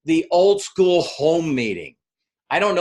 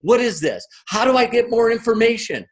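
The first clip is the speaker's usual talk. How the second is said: eager crowd; 6 semitones higher than usual. Retold or imitated. Curious people flood in asking Tara what to do, excited. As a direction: pile the questions on, hungry for more. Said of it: "everywhere going".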